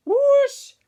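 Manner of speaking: high, loud whoosh and whoa; excited whoosh. A narrator's reading. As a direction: tumble head over heels with a cry. Pumpkin snaps, all tumble.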